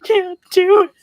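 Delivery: high, teary half-sob; tearful cry. Retold or imitated. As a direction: cry it out, overwhelmed by a sad ad. Describing crying at ads.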